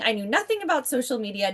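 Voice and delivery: normal talking voice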